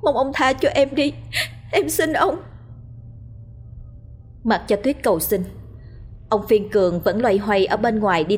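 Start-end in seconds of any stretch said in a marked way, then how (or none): 0.0-2.4 higher and higher pleading; tearful begging. A narrator's reading. Wife begging husband to spare her, tearful. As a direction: plead for mercy, close to tears. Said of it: "Cô khóc lóc van xin".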